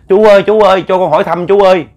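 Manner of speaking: high, loud call after a stranger; shouting out. Retold or imitated. Younger brother shouting after the stranger ahead, loud call. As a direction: shout to stop the man ahead. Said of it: "la lên nói là".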